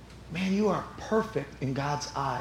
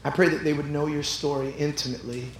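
soft closing prayer; 3 dB quieter than usual. Acted.

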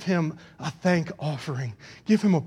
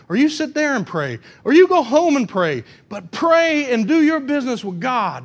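raised, emphatic pulpit urging; urgent exhortation; 8 semitones higher, 5 dB louder than usual. Real, not acted. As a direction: urge the congregation to pray. Preaches at full force.

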